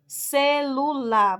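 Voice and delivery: slow, each syllable of "celular" separated; sílaba por sílaba. Shown as a demonstration. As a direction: stretch out "celular" as three beats, ce-lu-lar. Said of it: "três emissões de voz". ("celular" devagar, separando as sílabas)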